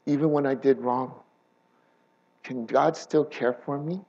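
barely voiced, as if asking yourself in shame; doubting inner voice. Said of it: "other times where we feel".

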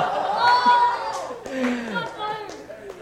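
very high whooping laugh; shrieking laugh. Real, not acted. Preacher howling with laughter at his old threat.

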